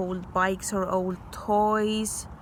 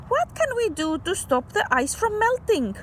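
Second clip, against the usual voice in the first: put-on eager child; 12 semitones higher than usual. Child asks dad eagerly.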